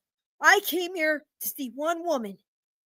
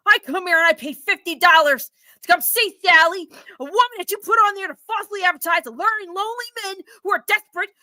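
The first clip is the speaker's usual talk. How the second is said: louder, higher fast tirade; outraged yelling; 4 semitones higher, 4 dB louder than usual. Acted. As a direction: let the outrage spill over. Customer yells at women.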